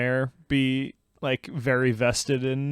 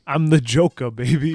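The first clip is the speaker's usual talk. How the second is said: loud, hammed-up Joker boast; showy mock-actor bragging; Joker impression; 8 dB louder than usual. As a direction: belt it out like a mock villain. Jokey movie impression.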